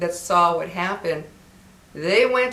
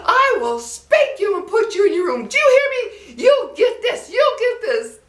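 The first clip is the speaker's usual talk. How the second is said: high-pitched, louder, furious scolding mother with cracks of laughter; angry mom yelling; 6 dB louder than usual. Retold or imitated. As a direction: shout it like an outraged mom threatening a spanking. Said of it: "she's like".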